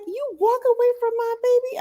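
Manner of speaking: very high-pitched, affronted question; imitated indignant mom. Mom questioning the nanny about her child, mock indignation. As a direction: mimic an incredulous mother, way up high.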